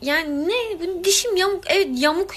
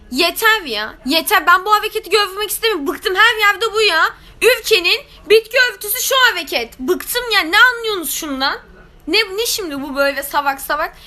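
higher, louder, exasperated; fed-up rant; 6 semitones higher, 4 dB louder than usual. Real, not acted. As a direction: rant like you've had enough. Akımdan bıktım çıkışı. (bıkkın, yüksek sesle söylenme)

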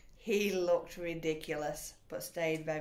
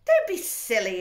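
loud, laughing, higher scoffing wife; dismissive wife; 4 semitones higher, 13 dB louder than usual. A narrator's reading. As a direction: laugh him off loudly. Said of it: "his wife said".

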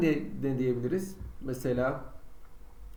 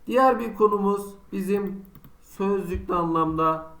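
usual speaking voice

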